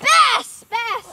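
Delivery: loud, high excited shout. Real, not acted. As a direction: yell it out in delight. Fish finally seen.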